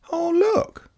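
lightly voiced child, high and offhand; casual child voice. A narrator's reading. Sam pointing out the dawn, casually high. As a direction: toss it off casually as a young boy. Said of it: "said Sam casually".

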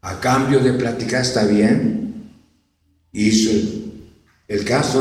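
calm reasonable parent. Acted. Calm parent agreeing to pay.

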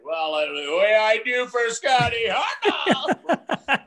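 high, slow laughing shout; excited tailgater. Retold or imitated. Tailgater tossing beers to Hartnell, shouting happily and laughing. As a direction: holler it like a thrilled fan handing over beer. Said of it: "And the guy's like".

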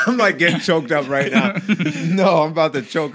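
loud, high voice breaking into laughter; laughing. Real, not acted. Guest nearly choking on coffee mid-story, laughing loudly. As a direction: crack up while trying to explain yourself. Laughing after host mistook his pause.

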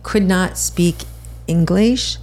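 ordinary talk, nothing marked

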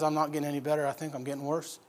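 very soft, low private thought; inward confession. Acted. Lazarus in his mind, hiding it from his sisters, inward confession. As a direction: sink into a hushed admission to yourself. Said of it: "in his mind, he says".

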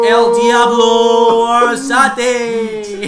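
huge drawn-out sung ring name; lucha libre announcer. Pretend. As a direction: stretch the fighter's name, very loud and high.

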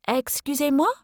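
mock French accent, question rising; a fugitive faking a French accent; put-on French accent. Faking French accent to fool the officer. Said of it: "in my best French accent".

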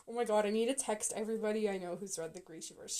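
normal talking voice

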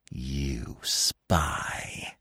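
low whispered hiss. A narrator's reading. Fox accusing the dog, contemptuous whispered hiss. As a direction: hiss it softly, full of hatred. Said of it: "he hissed".

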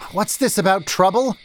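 high, sharp question; pointed demand. A narrator's reading. Merchant questioning the news of trouble, sharp. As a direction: demand an answer now.

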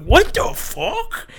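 very high, slow stunned question; shocked. Retold or imitated. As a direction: gape at it in disbelief. Friends' shocked reaction.

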